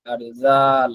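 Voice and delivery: ordinary talk, nothing marked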